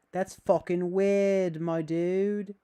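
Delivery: loud, slow, drawn-out disgust; hammed-up shout. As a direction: yell it out slowly like an outraged bro. Hammed-up reaction to chat.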